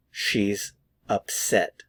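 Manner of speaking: "She's ... upset", slow with a pause after "She's"; word by word. Shown as a demonstration. Teacher models "She's upset" for learners, slow and word by word. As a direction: separate "She's" and "upset" slowly and clearly. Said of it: "Notice the pronunciation".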